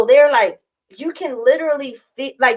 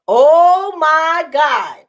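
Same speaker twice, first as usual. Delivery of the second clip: high, very drawn-out shock; overwhelmed exclamation; 5 semitones higher, 4 dB louder, much slower than usual. Retold or imitated. Patient feeling sudden pressure on her chest, stunned exclamation. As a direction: stretch every word as the pressure hits.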